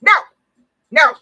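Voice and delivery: very high, loud, emphatic no; a firm boundary shouted at an intruder. Shown as a demonstration. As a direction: shut it down hard. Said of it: "give your no and mean that shit".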